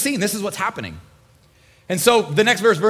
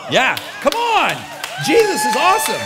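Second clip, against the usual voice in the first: excited praise; 6 semitones higher, 3 dB louder than usual. Real, not acted. Preacher erupting in praise.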